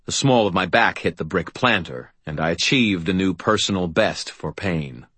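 ordinary delivery, nothing marked

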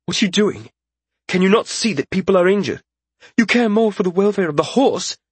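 high-pitched, affronted lordling voice; high, outraged character voice; indignant young lord. A narrator's reading.

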